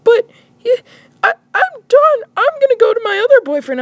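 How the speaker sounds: high, loud wailing girlfriend imitation; mock crying. Girlfriend on the phone to boyfriend, mock crying. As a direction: blubber through it in a squeal. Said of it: "then she started crying".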